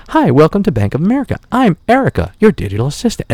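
a hint of a sweet automated assistant; mildly sing-song welcome voice; digital assistant greeting. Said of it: "I hear someone greet me".

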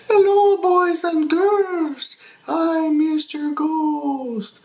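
high, slow, drawn-out ghost voice. Retold or imitated. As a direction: float every word like a cartoon ghost.

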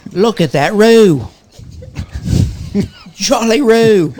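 slow, hammy Aussie drawl; Australian accent. Pretend. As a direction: lay on a thick Australian accent. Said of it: "I love the accent".

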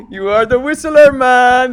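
high, loud mock Indian accent, laughing; teasing Indian accent. Clerk teasing the whistler, loud mock Indian accent. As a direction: rib him like the clerk. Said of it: "He goes".

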